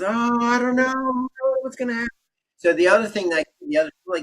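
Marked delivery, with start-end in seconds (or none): 0.0-2.1 high, worried, unsure voice; anxious fretting. Retold or imitated. Anxious daughter fretting about school, higher and worried. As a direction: sound lost and nervous about the unknown. Anxious girl contrasted with calm plan.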